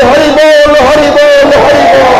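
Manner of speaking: preacher calling out loud to his listeners to praise Hari. Real, not acted. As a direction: lift everyone up with a ringing Hari bol. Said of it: "দুবাহু তুলে বলি".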